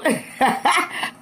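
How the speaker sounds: high, breaking-up giggle; real burst of laughter; amused laugh. Real, not acted. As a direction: crack up after telling it. Amused by retold exchange.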